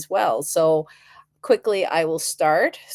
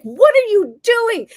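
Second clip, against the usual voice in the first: high, louder mock yell of an alarmed husband; 7 dB louder than usual. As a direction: fire it off like a startled husband. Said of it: "Arthur yelled at me".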